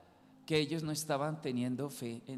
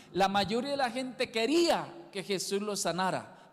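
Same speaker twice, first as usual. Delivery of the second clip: usual speaking voice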